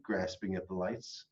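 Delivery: ordinary delivery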